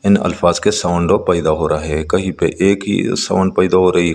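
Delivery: ordinary delivery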